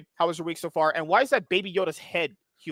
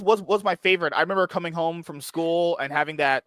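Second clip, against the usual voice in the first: usual speaking voice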